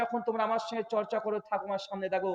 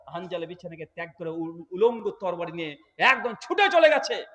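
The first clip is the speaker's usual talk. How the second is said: normal talking voice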